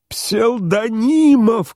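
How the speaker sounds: very high, long-drawn wail of a name; desperate shout. A narrator's reading. General reaching out to his host, desperate shout. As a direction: stretch the name into a frantic cry. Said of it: "кричал Иван Ильич".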